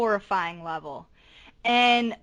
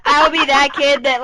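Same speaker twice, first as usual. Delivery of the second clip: usual speaking voice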